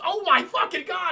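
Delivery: high-pitched mock horror; panicked exclamation. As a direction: blurt it out like a startled soldier, acted. Retold panic before shooting.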